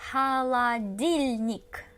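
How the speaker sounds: slow, careful delivery; slow model word. Shown as a demonstration. Teacher modelling a long word slowly for learners. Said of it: "I really recommend to split the word".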